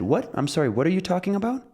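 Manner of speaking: high, quick puzzled question; baffled. Retold or imitated. Museum staff can't understand the visitor, baffled. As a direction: ask it as if you understood nothing, pitch climbing. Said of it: "the people couldn't understand".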